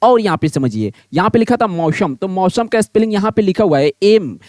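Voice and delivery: ordinary delivery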